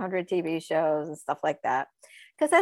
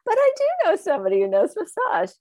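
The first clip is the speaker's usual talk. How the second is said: animated retold pitch; 10 semitones higher, 6 dB louder, faster than usual. Retold reply to agent.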